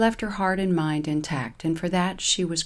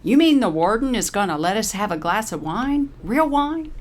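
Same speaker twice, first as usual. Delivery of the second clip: perking up; 5 semitones higher, 5 dB louder than usual. Acted. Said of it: "Paralee perks up".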